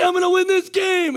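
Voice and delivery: very high, loud coach's yell; rallying shout. Retold or imitated. Coach making crying kids repeat him, rallying shout.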